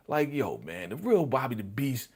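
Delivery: ordinary talk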